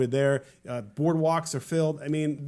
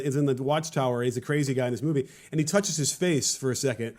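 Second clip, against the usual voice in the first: ordinary talk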